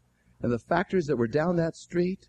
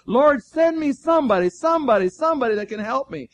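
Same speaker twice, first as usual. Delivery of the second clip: high, urgent retold plea to God; imitated pleading prayer; 7 semitones higher, 3 dB louder than usual. Preacher voices Moody praying for a helper, mock pleading. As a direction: plead the repeated word a little harder. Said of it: "Moody began to pray".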